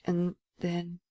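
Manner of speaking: soft, hesitant mutter; hushed, tentative murmur. A narrator's reading. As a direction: mutter it faintly, feeling for the words. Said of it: "he muttered".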